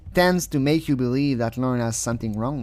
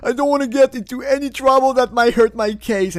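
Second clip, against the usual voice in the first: mock anxious inmate; 9 semitones higher, 4 dB louder than usual. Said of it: "He voiced anxiety".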